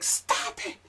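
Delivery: shrill high yelling in Japanese; panicked shriek of protest; freaking out. Retold or imitated. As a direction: girlfriend screaming at him in the club. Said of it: "She's, like, going off".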